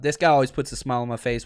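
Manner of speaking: normal talking voice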